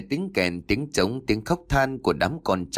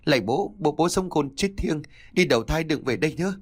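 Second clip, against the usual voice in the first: crying, pleading; 6 semitones higher than usual. A narrator's reading. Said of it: "vừa khóc vừa chớp tay mà vái".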